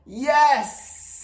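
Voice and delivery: high, loud yes with drawn-out hiss; hissed triumphant yes. A narrator's reading. Gamble wins his music back, hissing delight. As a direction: stretch it into a gleeful hiss. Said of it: "hissed Gamble".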